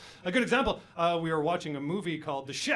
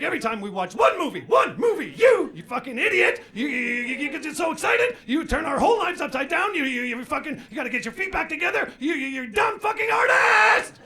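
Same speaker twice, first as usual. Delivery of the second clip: high, loud shouted rant as the wife; furious tirade; 9 semitones higher, 7 dB louder than usual. Retold or imitated. Wife lays into husband over food trucks, furious shouting. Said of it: "She lays into me".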